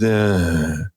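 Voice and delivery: low, drawn-out trailing sound; nebbish sentence ending. Retold or imitated. Mocking an audio presenter's habit, nebbish trailing "uh". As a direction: imitate his limp sentence ending. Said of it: "ends every sentence kind of".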